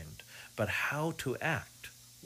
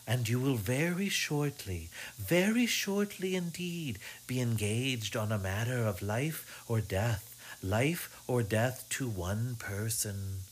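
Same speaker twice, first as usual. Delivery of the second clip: fortune teller; slower than usual. Acted. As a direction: drone it like a crystal gazer. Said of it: "her sing-song, droning voice".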